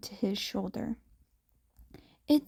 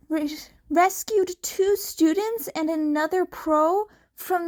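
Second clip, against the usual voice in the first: tense, wobbling; 7 semitones higher than usual. Acted. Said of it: "Her voice was tense, wobbling slightly".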